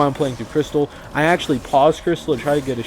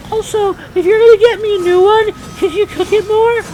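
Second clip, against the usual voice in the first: much higher, louder put-on customer voice; mock fussy customer; 5 dB louder than usual. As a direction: ham up the demanding diner. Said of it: "So then he's like".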